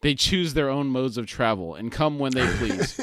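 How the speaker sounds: usual speaking voice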